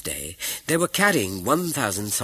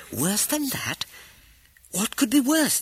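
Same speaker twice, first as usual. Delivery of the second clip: high, incredulous echo of "Worse than that?"; alarmed disbelief. A narrator's reading. Wife echoes husband's grim news, high incredulous alarm. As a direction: throw it back up high, shocked.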